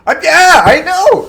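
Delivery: very high, loud outburst; emphatic agreement. Real, not acted. Man agreeing he had every reason to be scared, worked up. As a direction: burst out in agitated agreement.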